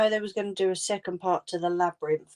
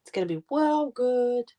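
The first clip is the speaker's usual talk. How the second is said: higher, eager, stressing well; excited; 6 semitones higher than usual. Real, not acted. Telling the viewer about the sequel, excited. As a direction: gush about the film.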